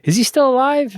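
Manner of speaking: high surprised question, imitating Jeff. Scene: imitating Jeff's surprise during the interview, high question. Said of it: "And Jeff says".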